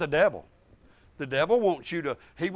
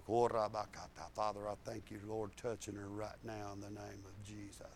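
soft, slow, murmured prayer; hushed prayer; 11 semitones lower, 15 dB quieter than usual. Retold or imitated. Preacher praying softly over the bent old woman. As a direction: pray it low and slow over her.

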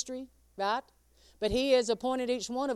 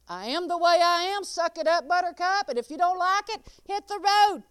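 mock defiant retort; 7 semitones higher, 6 dB louder than usual. Retold defiant self.

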